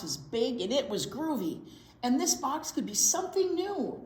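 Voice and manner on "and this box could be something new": ordinary delivery, nothing marked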